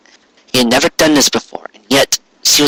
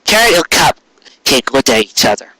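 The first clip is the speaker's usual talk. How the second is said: ordinary delivery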